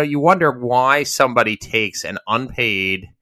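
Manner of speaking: normal talking voice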